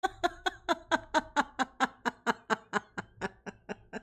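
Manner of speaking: high, breathless laughing fit; hearty laughter. Real, not acted. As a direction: laugh helplessly. Laughing at clip replay.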